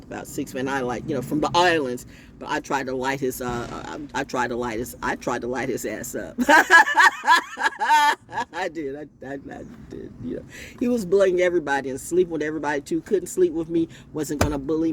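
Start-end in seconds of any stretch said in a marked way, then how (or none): 6.4-8.5 loud high laugh. Real, not acted. Laughing hard about lighting his hair. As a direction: burst of loud cackling laughter. Laughing at her own story.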